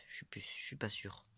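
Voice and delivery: a soft unvoiced whisper, half to himself; whispered aside. Real, not acted. Showing his finds, he whispers his doubt to himself. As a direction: breathe it out as an unsure aside.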